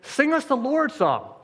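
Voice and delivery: high, sneering taunt; sing-song jeer at the captives; mocking taunt. Babylonian captors taunting the exiled Israelites, mocking. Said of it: "Because they were mocked".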